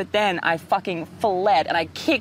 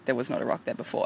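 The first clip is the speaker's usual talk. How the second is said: hushed realization; 4 semitones lower, 6 dB quieter than usual. Retold or imitated. Realizing shark is there.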